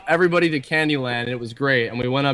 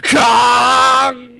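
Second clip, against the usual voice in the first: high, loud shouted spell; magic word shout; 9 semitones higher, 6 dB louder than usual. Acted. Charging the laser and shouting the spell. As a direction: blast the magical phrase out. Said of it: "the magical phrase".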